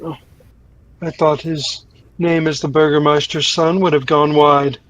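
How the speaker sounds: usual speaking voice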